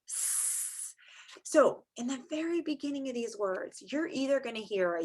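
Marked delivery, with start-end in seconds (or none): 0.1-0.9 long voiceless "sss"; snake hiss. Shown as a demonstration. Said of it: "snakes say".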